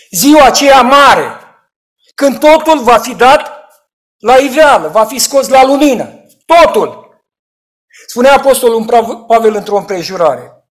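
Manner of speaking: loud, high sermon warning; rising preacher's rebuke; fiery preaching. Acted. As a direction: thunder it from the pulpit. Preaching at full force.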